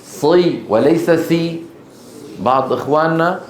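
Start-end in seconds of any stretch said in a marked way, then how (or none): 0.0-1.6 صي with heavy emphatic sad, سي with light thin seen, slowly; sad against seen. Shown as a demonstration. Teacher correcting students who say سي for صي, slow. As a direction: contrast صي and سي slowly. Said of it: "انتبهوا للمكسور".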